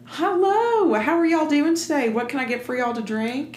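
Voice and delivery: put-on bright, high Southern waitress accent for tips; syrupy Southern server greeting; deep Southern accent. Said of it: "You throw on a deep Southern accent".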